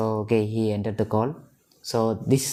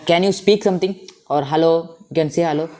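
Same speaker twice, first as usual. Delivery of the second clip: loud, higher, raised call; 6 semitones higher, 7 dB louder, faster than usual. Real, not acted. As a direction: call out so the friend hears. Asks friend to speak on call.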